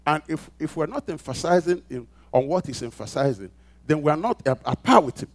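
loud, high, driving pulpit voice; preaching at full force. Acted. Pastor rousing the congregation, preaching at full force. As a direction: build it up and hammer each phrase.

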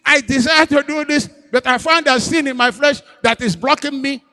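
high, loud, slowed plea; Paul's anguished lament. Retold or imitated. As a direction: cry out as Paul in frustration.

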